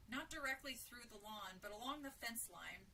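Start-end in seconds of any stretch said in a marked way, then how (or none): none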